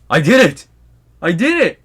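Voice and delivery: loud, high victory shout; triumphant cheer. Retold or imitated. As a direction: crow over the win. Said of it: "he's just like".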